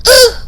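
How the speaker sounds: short imitated heave grunt; quick mock effort noise. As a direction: grunt like tossing something away. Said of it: "but she's like".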